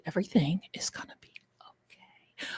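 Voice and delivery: soft whispered comfort; whispered reassurance. Real, not acted. Streamer soothing her viewers, whispered reassurance. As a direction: hush it to them like a secret.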